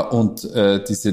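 normal talking voice

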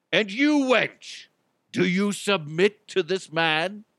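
high, projected ceremonial voice, drawn-out address; Renaissance festival minister. Acted. Minister asking the bride to submit, pompous proclamation. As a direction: proclaim it like a pompous faire officiant. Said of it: "I imagine the minister would be".

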